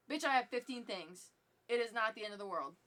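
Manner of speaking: loud, indignant comeback; sharp retort. Retold or imitated. Retold retort to old lady.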